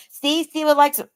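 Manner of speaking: lightly mimicked tattletale; mocking critic. Mimics critics twisting her words.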